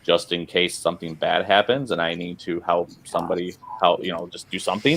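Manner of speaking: usual speaking voice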